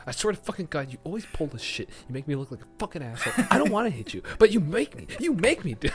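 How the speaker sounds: a hint of a raging husband; mock abusive rant. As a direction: rant at the wife. Husband ranting at wife.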